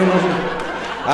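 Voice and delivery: ordinary delivery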